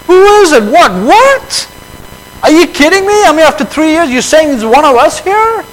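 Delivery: high, startled disbelief; shocked disciples. Retold or imitated. Disciples hearing of a traitor, high shocked disbelief. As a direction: play the stunned disciples in disbelief. Disciples react to betrayer news.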